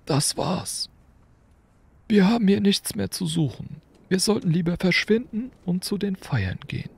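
put-on high, kindly old wizard, lightly curious; an amused old headmaster asking; Dumbledore. A narrator's reading. Dumbledore questioning Hagrid about the motorbike, amused.

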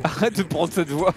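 laughing. Real, not acted. Laughing at friend's driving.

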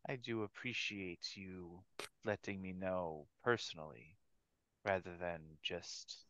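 low, quiet, menacing devil. Acted. GM voices Fatala replying.

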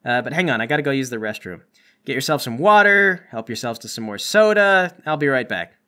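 usual speaking voice

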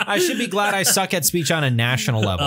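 laughing through the words; giggling while talking. Real, not acted. Laughing at his own failure.

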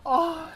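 short loud cry of frustration; sharp high "ah" of annoyance; pained yelp. Real, not acted. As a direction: yelp out as you die. Frustrated gamer cries out.

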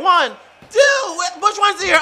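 screaming the pin count, high and loud; shouting the count. Acted. Commentator yelling the pin count to the crowd. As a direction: yell the count like a hyped announcer.

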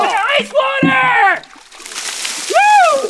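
loud excited yell; shouting. Real, not acted. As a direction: shout it out, cheering. Shouting items during ice dumps.

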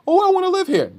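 very high-pitched mock gushing voice; mocking newcomer. Comic mocking people moving to Brooklyn, high mocking voice. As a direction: mimic an outsider in a silly high voice.

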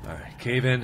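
ordinary delivery

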